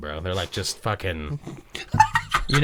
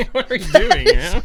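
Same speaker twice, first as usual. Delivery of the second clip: laughing, much higher and louder; 9 dB louder, slower than usual. Real, not acted. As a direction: crack up in disbelief. Laughing disbelief.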